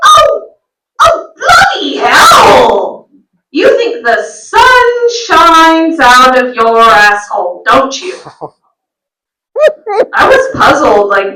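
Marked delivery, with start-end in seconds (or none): 0.1-8.1 high, slow British mum scoffing; drawn-out high British exclamation; scornful British mum. Retold or imitated. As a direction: sneer like an outraged British mother. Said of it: "thick accent".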